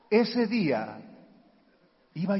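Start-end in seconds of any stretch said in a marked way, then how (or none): none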